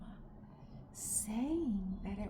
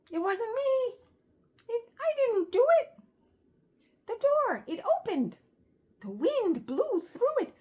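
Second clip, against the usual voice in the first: high, loud, hurried protest of a guilty little llama; Llama's frantic excuse; 12 semitones higher, 10 dB louder than usual. Acted. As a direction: squeal it out like a caught kid pleading innocence. Llama denies breaking mama's frame.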